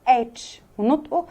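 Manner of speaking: ordinary talk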